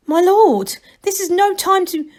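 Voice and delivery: high, urgent and cut short; urgent protest. A narrator's reading. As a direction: plead before being interrupted.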